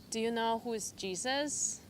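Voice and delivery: usual speaking voice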